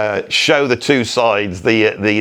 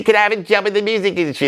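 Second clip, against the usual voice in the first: eager son, high and fast; a spoiled lad begging his dad, rushing; posh son; 6 semitones higher, much faster than usual. Acted. Posh son asks dad for job.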